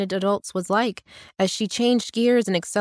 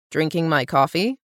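lower, unhurried, unbothered reply; slow calm man; 5 semitones lower than usual. A narrator's reading. Carpenter calmly answers the startled cabin owner, low and slow. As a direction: answer easy and slow, like nothing's odd. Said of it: "the man said slowly and calmly".

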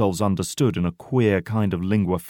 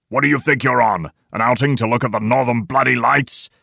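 loud, raised, scornful questioning; enraged shout; 4 semitones higher, 6 dB louder than usual. A narrator's reading. Old man scolds the section over coffee, raging. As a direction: rage at them in disbelief. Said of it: "shouts the old man in a rage".

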